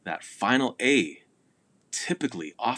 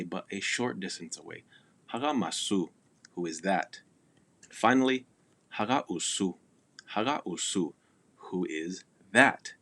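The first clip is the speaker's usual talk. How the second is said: ordinary delivery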